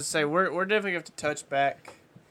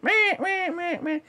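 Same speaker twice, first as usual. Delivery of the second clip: high quacking hen mallard; duck call honks; much slower than usual. Retold or imitated. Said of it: "As mimicking".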